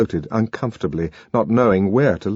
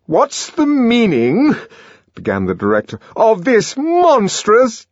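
outraged director; 13 semitones higher, 5 dB louder than usual. A narrator's reading. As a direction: splutter with outrage.

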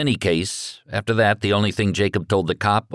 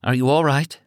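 wobbly voice; 8 semitones higher than usual. A narrator's reading. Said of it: "Lori said in a wobbly voice".

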